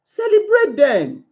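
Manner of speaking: ordinary talk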